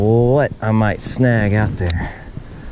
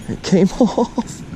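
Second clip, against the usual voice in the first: rueful laugh; 9 semitones higher, 4 dB quieter, slower than usual. Real, not acted. Fish gets away.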